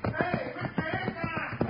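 long drawn-out far-off holler; distant calling out. Acted. Sheriff hails Hickok from afar, drawn-out holler. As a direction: call to someone down the street.

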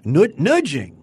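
put-on nudging said in a Yiddish-tinged accent.

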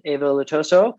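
ordinary talk, nothing marked

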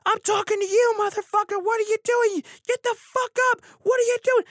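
loud, high enraged screech; screaming stranger. Retold or imitated. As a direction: imitate her furious high-pitched screaming. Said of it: "screaming at the top of her lungs".

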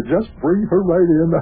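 shouting down to Sparky to send her in; calling out. Acted. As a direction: call it out to the next room.

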